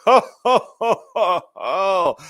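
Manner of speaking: gleeful mock Santa laughing at his own joke; laughing Santa Claus. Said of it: "he's Santa Claus this year".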